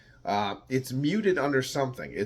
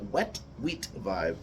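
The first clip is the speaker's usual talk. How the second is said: "Wet wheat vibe" drawn out slowly and a bit lower; slowed, savoring; 3 semitones lower, 4 dB quieter, slower than usual. Shown as a demonstration. Repeating his own tasting phrase to the viewers, slow and relishing. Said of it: "how did I say?".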